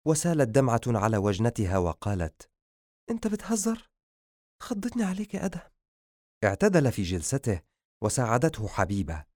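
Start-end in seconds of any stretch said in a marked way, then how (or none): 3.1-5.7 high-pitched, softened reproach; relieved scolding. Acted. Habiba tells Adham he scared her, gently scolding. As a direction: chide him gently after a fright.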